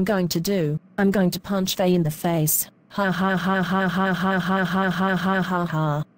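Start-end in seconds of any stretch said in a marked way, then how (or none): 2.9-6.0 long hammed-up villain cackle, ha ha ha; evil laugh. [name] gloats over her plan to punch Faye, evil cackle. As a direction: cackle like a cartoon bully plotting.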